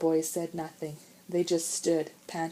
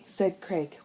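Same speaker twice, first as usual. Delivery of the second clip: plain reading voice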